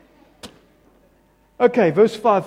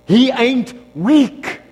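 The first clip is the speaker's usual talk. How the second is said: louder, higher and slow; the booming repeat that drives it home; loud, slow declaration; 7 semitones higher, 9 dB louder, much slower than usual. Shown as a demonstration. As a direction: preach it, hitting weak hard.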